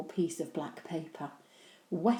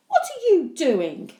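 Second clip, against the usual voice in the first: demanding; 10 semitones higher, 11 dB louder than usual. Acted. Mum catches Henry.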